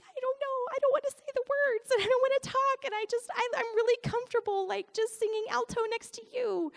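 very high-pitched, squeaky protest; hammed-up pleading in a tiny high voice; mock panicked whimper. To husband, scared to lead worship, mock panicked pleading. Retelling her tearful reluctant protest.

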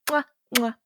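two quick kissing sounds; mwah, mwah kisses; double air kiss. Real, not acted. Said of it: "I give 'em each a little kiss".

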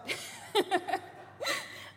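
short high breathy laugh; a quick airy giggle. Real, not acted. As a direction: laugh at your own joke. Laughs at own joke.

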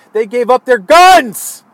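loud, slow hammering on the last word; angry outburst. Real, not acted. Ranter on Venezuela giving up guns, angry and loud.

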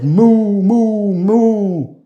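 high loud moos; duck mooing. A narrator's reading. Duck luring thieves into the pond, loud high moo. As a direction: moo like a cow. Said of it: "the duck on the pond said".

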